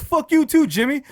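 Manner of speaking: high, loud retort; mock-furious imitation, lashing back; mad. Coworker turning on the prankster, mad. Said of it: "He was so mad at me".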